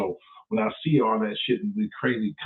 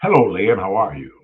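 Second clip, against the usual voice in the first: ordinary talk, nothing marked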